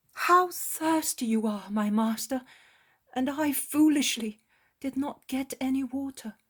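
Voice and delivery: John fretting over the master's thirst; John, sorry and tender. A narrator's reading. John voiced, regretful.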